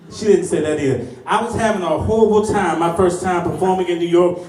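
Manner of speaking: normal talking voice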